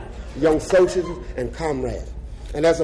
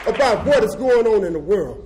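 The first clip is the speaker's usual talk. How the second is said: higher and louder, building to a shouted "stand up"; fiery rally speech; 9 semitones higher, 5 dB louder than usual. Real, not acted. Former death row prisoner rousing socialist comrades, fiery preaching. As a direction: whip the crowd up, voice climbing to a shout.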